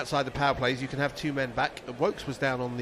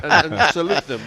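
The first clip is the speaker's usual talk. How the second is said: laughing; 3 semitones higher, 12 dB louder, faster than usual. Real, not acted. Laughing at fielders' habit.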